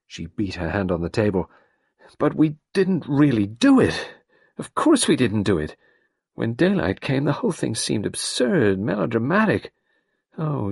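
put-on Carol, young and overwrought; distressed young woman. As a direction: pour it out, near tears. Carol insists innocence.

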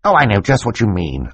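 plain reading voice

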